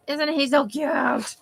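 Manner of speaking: slow, gushing coo; overwhelmed by cuteness. Real, not acted. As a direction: melt over the puppy, slowly. Gushing over a puppy picture.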